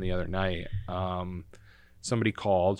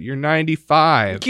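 loud, raised-pitch retold comeback; higher and louder, in mock exasperation; exasperated retort; 7 semitones higher, 10 dB louder than usual. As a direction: act out a grandchild's emphatic reality check. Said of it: "And I said".

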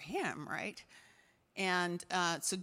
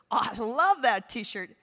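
loud, slow gushing exclamation; emphatic, drawn-out enthusiasm; retold delight; 3 semitones higher, 9 dB louder, slower than usual. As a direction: lean hard and long into the compliment. Acts out own delighted exclamation.

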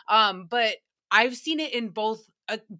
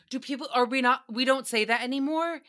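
ordinary talk, nothing marked

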